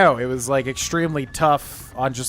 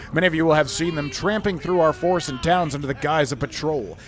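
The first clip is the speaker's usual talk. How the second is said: lively, lilting dramatic read-aloud; theatrical letter reading; 4 semitones higher than usual. Acted. Streamer reading Damon Briska's warning about strange riders, theatrical narration. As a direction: declaim it as a proud citizen's public notice. Reads an in-game notice aloud.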